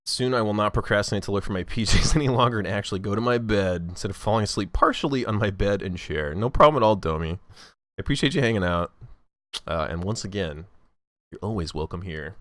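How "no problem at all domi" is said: usual speaking voice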